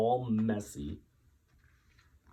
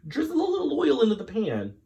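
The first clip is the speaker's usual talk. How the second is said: hurried; 6 dB louder, faster than usual. Acted. Hurried instruction.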